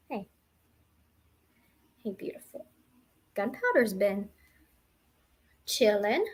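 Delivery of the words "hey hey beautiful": whispered pet coo. Real, not acted. Cooing to her pet.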